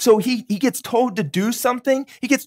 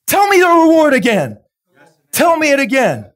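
loud, higher, building sermon climax; preaching at full force; 4 semitones higher, 4 dB louder than usual. Acted. Preacher rallying the church about God's reward, building. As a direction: drive the promise home to the crowd.

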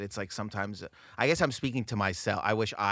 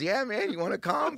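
breezy buddy invite; 12 semitones higher than usual. Retold or imitated. Comedian friend invites to show.

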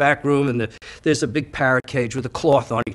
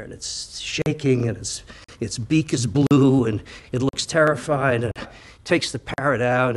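usual speaking voice

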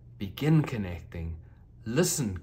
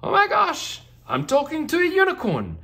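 very high, loud squeal of delight; a girl thrilled to meet a unicorn; shrieking with joy; excited little girl; 10 dB louder than usual. Acted. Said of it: "exclaimed the little girl excitedly".

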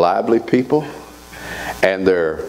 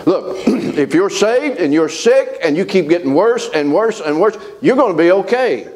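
fervent preaching; 8 semitones higher than usual. Real, not acted. Preacher reassuring the sick congregation with fervor. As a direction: push the promise to the congregation.

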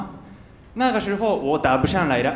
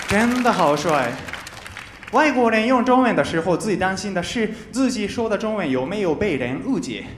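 normal talking voice

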